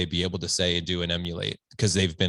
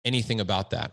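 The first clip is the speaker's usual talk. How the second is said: ordinary talk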